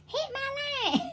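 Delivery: mock high voice; high spirit voice. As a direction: voice a flirty spirit. Voicing spirit.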